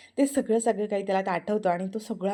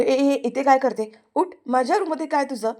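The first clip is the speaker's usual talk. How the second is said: bossy order; 4 semitones higher, 6 dB louder than usual. Acted. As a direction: drive her off the sofa. Prithvi orders her off.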